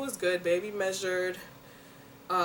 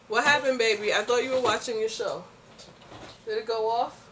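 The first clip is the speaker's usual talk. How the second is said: higher, louder coaxing mom voice; 4 semitones higher, 4 dB louder than usual. Real, not acted. Mother asks daughter London why her show stopped, coaxing. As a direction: coax the little one gently.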